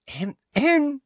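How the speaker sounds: very high, affected throat-clearing; Umbridge, fake cough. A narrator's reading. Inspector seeking the oblivious teacher's attention, fake cough. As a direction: demand notice with a little fake cough. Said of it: "Umbridge's fake cough".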